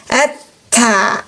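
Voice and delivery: "At-ta" with a light, thin second syllable. Shown as a demonstration. Teacher explaining the letter ta, thin "At-ta". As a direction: hold the sound briefly on the t, then a thin "ta" in "At-ta". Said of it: "bunyi huruf ta tipis".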